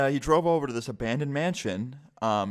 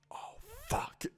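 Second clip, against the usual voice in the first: barely audible, whispered curse; hushed dread; 12 dB quieter than usual. Retold or imitated. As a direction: let it out under your breath, acting caught. Retold dread at being caught.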